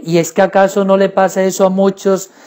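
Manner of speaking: usual speaking voice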